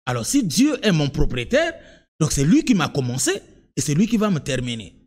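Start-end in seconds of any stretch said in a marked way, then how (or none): none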